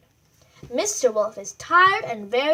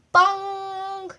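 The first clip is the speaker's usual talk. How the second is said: clock bong; 6 semitones higher, 5 dB louder than usual. Acted. Clock strikes in story.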